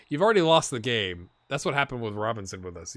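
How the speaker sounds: ordinary talk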